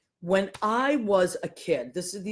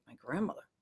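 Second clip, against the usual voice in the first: whisper; 5 semitones lower, 10 dB quieter than usual. Retold or imitated. Kid surprised in front of principal.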